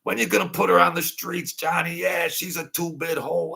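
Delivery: high, mocking cackle of the guys teasing; high jeering mimicry of the guys laughing. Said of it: "And they're laughing".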